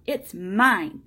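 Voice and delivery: defiant claim. Acted. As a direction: insist on it crossly and loudly. Boy quarrels with the man.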